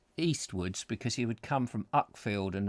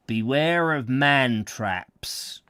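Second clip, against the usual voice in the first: stern sign reading; 4 dB louder, much slower than usual. Acted. Reading a warning sign aloud.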